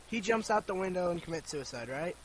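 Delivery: normal talking voice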